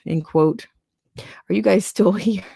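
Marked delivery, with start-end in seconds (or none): 1.2-2.4 breaking into a laugh mid-question; can't hold the laugh in; giggling through the words. Real, not acted. Laughing at the article mid-stream.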